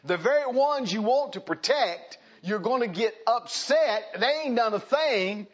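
high, loud, impassioned rebuke; full-force preaching. Real, not acted. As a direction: drive the rebuke home at full voice.

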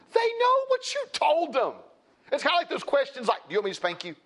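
high-pitched, fervent exhortation; impassioned preaching. Real, not acted. Preacher admonishing parents about consistency, impassioned and high-pitched.

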